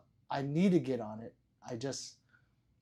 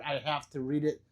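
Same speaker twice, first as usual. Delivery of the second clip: ordinary delivery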